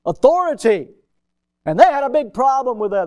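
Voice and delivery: high, loud "Authority"; hammered point. Real, not acted. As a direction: drive the word home. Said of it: "preaching emphasis".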